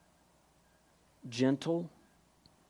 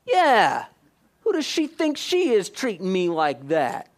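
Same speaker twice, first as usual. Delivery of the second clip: indignant agreement; 3 semitones higher, 5 dB louder than usual. Retold or imitated. Man echoing the enemy's whisper, getting indignant. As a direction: get riled up and agree out loud. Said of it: "you go".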